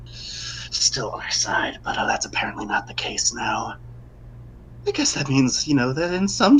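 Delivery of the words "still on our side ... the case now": whisper. Acted. Whispered aside during call.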